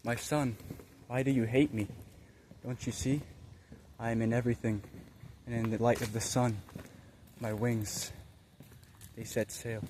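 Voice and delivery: hushed, solemn words with long pauses; voice of the Spirit. Retold or imitated. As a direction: speak gently as the Spirit to a son. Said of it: "The Spirit said".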